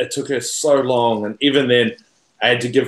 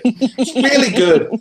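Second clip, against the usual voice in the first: sarcastic; 11 semitones higher, 4 dB louder, slower than usual. Real, not acted. Sarcastic after listing teasers.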